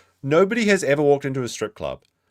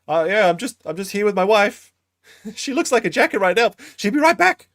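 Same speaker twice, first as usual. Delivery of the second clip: high, loud mock voice; mocking re-enactment; 10 semitones higher, 7 dB louder than usual. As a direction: squeal it out, mimicking the storyteller's excuse. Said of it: "so this is what happened".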